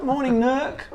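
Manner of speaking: put-on high, loud New Zealand accent; Kiwi accent. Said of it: "speaking to each other in a Kiwi accent".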